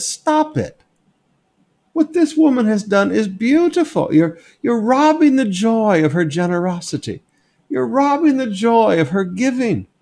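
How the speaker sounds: high, earnest rebuke; earnest, high voiced Jesus; pleading rebuke. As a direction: plead for the woman's joy, pitched high.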